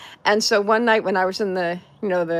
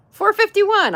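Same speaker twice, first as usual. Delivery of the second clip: much higher, louder number; much higher excited cheer at the number; excited count; 12 semitones higher, 5 dB louder, slower than usual. Real, not acted. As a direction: announce it with glee. Excited read of sub count.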